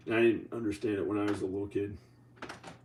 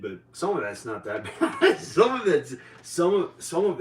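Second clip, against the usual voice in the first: laughing; 7 semitones higher, 6 dB louder than usual. Real, not acted. Laughs mid-sentence about lust.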